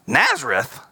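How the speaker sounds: a touch of surprise; lightly scoffing at the town; understated incredulous exclamation; mild exclaim. A narrator's reading. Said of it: "exclaimed Nathanael".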